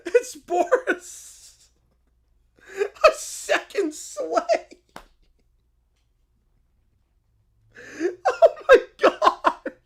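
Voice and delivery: high, slow laughing cry; helpless laughing reaction; laughing disbelief. Real, not acted. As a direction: drag it out while laughing. Cracking up at the twist.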